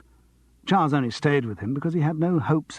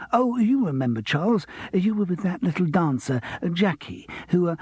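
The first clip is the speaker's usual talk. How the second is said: higher, gushing showbiz voice; eager pianist voice; 6 semitones higher than usual. Acted. Pianist reminding Charles of panto, higher gushing voice. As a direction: gush with eager recognition. Norman recalls Jackie.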